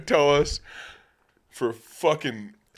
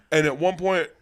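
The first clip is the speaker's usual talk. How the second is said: usual speaking voice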